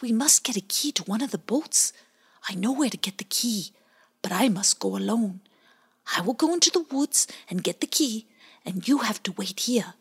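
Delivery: whispered, secretive little otter; whispered plan. Acted. Bowie whispers his plan to Pie Jam. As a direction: hush it like a secret.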